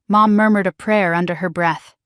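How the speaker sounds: ordinary delivery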